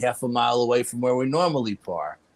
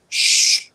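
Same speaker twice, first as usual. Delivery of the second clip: a sharp breathy shush to hush him; urgent shush; 4 dB louder than usual. Retold or imitated. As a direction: hush him fast, scared he'll jinx it. Hushing cousin about skinwalkers.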